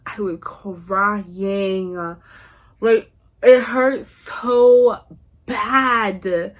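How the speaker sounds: "crying" and "so bad" dragged out long; dramatic emphasis. Dramatic retelling of crying over breakup.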